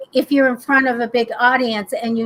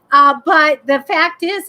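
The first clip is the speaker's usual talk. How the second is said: ordinary talk, nothing marked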